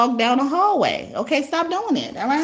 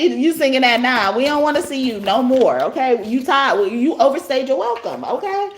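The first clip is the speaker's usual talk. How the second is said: ordinary talk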